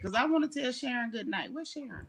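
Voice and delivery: normal talking voice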